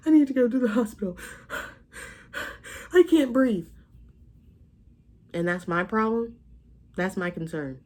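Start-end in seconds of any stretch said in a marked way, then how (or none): 0.1-3.7 higher, louder, fast and strained, mock breathless; hammed-up gasping plea, pushed out quickly; voicing the sick ex begging for help; imitating a sick man. Ex calling, claiming he can't breathe.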